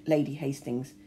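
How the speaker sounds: usual speaking voice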